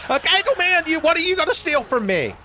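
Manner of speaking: high, loud taunting call; mock taunt. Player taunting the Eagle Man enemy, high mocking squeal. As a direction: squeal a challenge at the thief.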